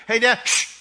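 put-on high, loud child's shush; a kid in the back seat telling Dad to hush. Kid hushing dad in car.

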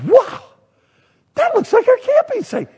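high-pitched exclamation of recognition; amazed. Retold or imitated. Husband to wife seeing the postcard, amazed.

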